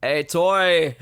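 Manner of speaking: loud, hammed-up Australian greeting; Aussie accent. Greeting a new patron for a ringtone, mock Aussie accent. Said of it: "the Aussie version".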